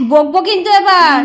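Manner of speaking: half-laughing playful threat; mock scolding. Real, not acted. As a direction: pretend to scold through a laugh.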